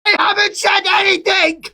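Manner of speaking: high-pitched protest, yelled out; indignant shout. Real, not acted. Indignant denial in an argument.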